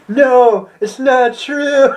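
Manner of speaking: hammed-up Luke anguish; Luke wailing. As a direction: wail it like Luke in denial. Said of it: "Luke's like".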